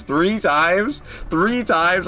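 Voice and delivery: high disbelieving three times; incredulous. Real, not acted. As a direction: echo it back in shock. Disbelief at chat.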